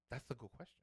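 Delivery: measured, lisping retold reply; imitated lisp. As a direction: lisp it like the sassy trainer. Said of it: "He had a lisp".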